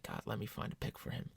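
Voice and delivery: soft unvoiced plea; whispered prayer. Praying to find Zach a pick, whispered. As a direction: pray it under your breath. Retold prayer while searching.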